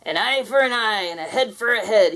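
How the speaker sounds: roaring ghost pirate. Acted. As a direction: roar it like a pirate. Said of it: "he roared".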